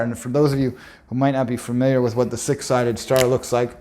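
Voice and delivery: ordinary talk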